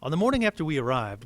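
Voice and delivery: ordinary delivery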